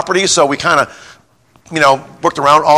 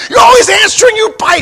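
much higher, louder furious yell; mad psychiatrist yelling; 6 dB louder than usual. Retold or imitated. Psychiatrist losing his temper at the pastor, furious high yell. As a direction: blow up like a man who's lost it. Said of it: "He got so mad".

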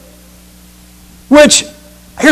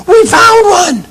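high, slow gleeful shout; excited girls; 13 semitones higher, much slower than usual. Retold or imitated.

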